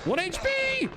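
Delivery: high, alarmed squeal; alarmed shout. Real, not acted. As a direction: yelp it way up high. Health drops to one.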